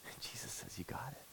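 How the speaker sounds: unvoiced hushed whisper. Real, not acted. Preacher telling of Jesus' answer to Peter, hushed whisper. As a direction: breathe it out as a secret.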